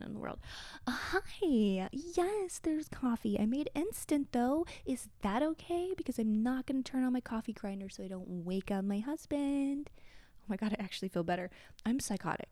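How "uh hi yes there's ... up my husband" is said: overly bright pretend-happy greeting; fake cheerful host. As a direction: put on a sunny welcome for guests who aren't there. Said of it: "pretend like we're the happiest person".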